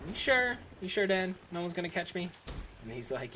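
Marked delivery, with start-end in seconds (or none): 0.1-2.3 put-on higher, pleading kid asking for reassurance; a worried boy double-checking with his dad, pitched up; anxious kid. Young runner begs his dad for a promise, higher and pleading.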